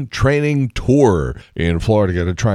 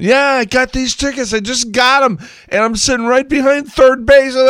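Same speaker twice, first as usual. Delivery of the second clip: much higher, louder mock voice of a loudmouth on his cell; hammed-up guy bragging into his phone, higher and louder; loud phone talker; 4 dB louder than usual. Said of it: "talking really loud on the cell phone".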